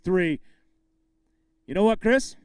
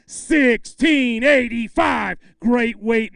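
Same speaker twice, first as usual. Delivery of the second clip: loud, very slow shouted number; weight announcement; 4 semitones higher, 7 dB louder, much slower than usual. Real, not acted. Emcee shouting the bag weight to the crowd, slow and loud.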